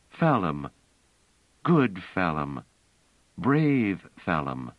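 slow, gentle encouragement; coaxing praise. Acted. Bliss praising the child by its name, gently.

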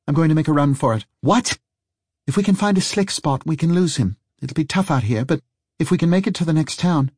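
fast, high, urgent plan; urgent excitement. Acted. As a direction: rush it out, eager to run. Harry plans to flee.

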